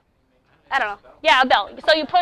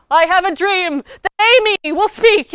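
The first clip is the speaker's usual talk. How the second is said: higher, louder grandiose orator; grand oratory; 6 semitones higher, 9 dB louder than usual. Retold or imitated. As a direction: boom it like a great civil rights speech. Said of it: "that's not appropriate".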